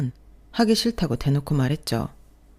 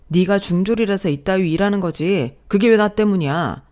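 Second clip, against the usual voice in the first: louder, sneering yell; angry shout; 3 semitones higher, 5 dB louder than usual. Acted. Husband shouting that her job is not his fault, angry shout. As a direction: throw the blame back hard.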